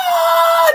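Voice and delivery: loud, high imitated scream; mock yelling. Sister stuck in the vacuum, imitated scream. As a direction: yell your head off in play. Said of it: "just yelling her head off".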